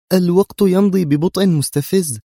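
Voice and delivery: high, loud, edgy complaint; nervous irritation. A narrator's reading. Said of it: "نطقت منى العبارة في عصبية". (بعصبية ونفاد صبر)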